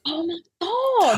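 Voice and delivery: high, drawn-out gasp of amazement; awed realization. Real, not acted. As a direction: let it dawn slowly, voice climbing. Sudden realization about the show.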